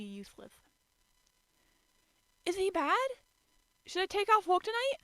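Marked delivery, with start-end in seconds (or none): 2.4-5.0 high, loud, worried rush; anxious outburst. A narrator's reading. Toru anxiously asking about sick Yuki, high worried burst. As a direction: blurt it out in alarm. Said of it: "she burst".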